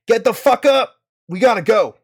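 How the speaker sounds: loud, high-pitched urgent yell; wake-up shout. Acted. Joran finds T B asleep, urgent wake-up shout. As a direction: yell to rouse a sleeper. Character shouts a sleeper awake.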